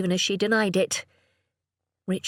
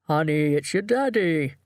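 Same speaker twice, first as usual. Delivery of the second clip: coaxing father. A narrator's reading. Father coaxing his shy daughter.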